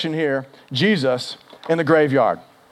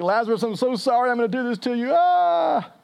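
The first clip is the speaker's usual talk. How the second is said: mock crying apology; 7 semitones higher than usual. Jesus about to raise Lazarus to die again, mock crying. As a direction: apologize tearfully, hammed up, ending in a high wail. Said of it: "there's one possibility that he's crying".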